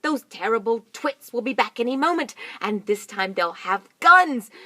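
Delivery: loud, high urgent warning. Acted. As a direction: warn them the twits are coming. Mugglewump warning.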